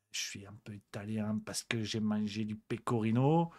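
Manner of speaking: whispered, mocking the waiter's fake Italian airs. Mocking the waiter who puts on an accent, whispered. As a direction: breathe it out as a snide aside. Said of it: "le mec se trouve un accent".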